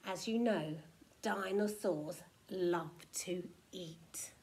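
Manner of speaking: plain reading voice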